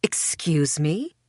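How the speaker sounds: slow, rising shocked question; understated horror, drawn out; horrified. A narrator's reading.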